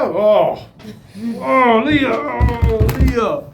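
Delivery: high, loud exasperated call. Retold or imitated. Father calling out to daughter Lía, exasperated. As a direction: call her name in exasperation. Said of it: "A Lía".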